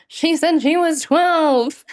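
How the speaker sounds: high, slow mock quote; imitating his delivery. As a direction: copy the odd way he says it. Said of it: "Why does he say it like that?".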